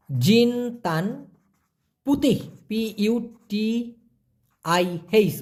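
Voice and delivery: জিনতান drawn out, পুতিহ spelled letter by letter; careful spelling out. Shown as a demonstration. Teacher showing learners the pronunciation, জিনতান পুতিহ slowly spelled out. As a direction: pronounce জিনতান পুতিহ with pauses, then spell P U T I H. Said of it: "উচ্চারণটা দেখুন".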